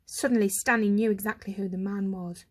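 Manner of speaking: ordinary delivery, nothing marked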